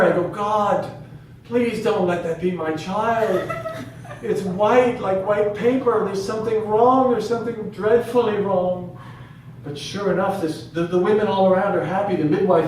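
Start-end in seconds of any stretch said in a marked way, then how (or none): 0.3-9.0 higher, louder pleading with God; anxious prayer. Retold or imitated. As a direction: pray in alarm, pitch raised. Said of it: "I say a prayer".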